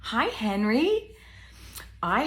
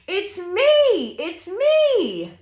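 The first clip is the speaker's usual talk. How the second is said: high, sing-song reveal with stretched ME; gleeful high animal voice for kids; excited sheep; 9 semitones higher, 7 dB louder, slower than usual. Acted. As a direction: announce yourself gleefully as the sheep.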